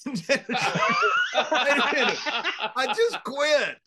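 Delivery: high-pitched, drawn-out comic cry; acted-out belated outrage, high and slow; exasperated mock protest. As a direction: stretch out a disbelieving mock wail. Acting out Shawn's delayed protest.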